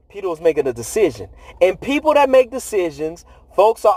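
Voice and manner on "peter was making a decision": ordinary delivery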